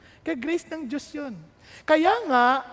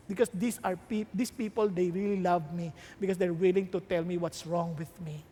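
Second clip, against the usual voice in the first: ordinary talk, nothing marked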